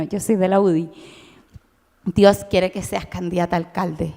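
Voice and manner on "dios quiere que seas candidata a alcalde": lower, grave imitation of a pious politician; lightly voiced solemn adviser invoking God; mock solemn politician. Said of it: "una amiga que me dijo". (imitación solemne y grave, más baja)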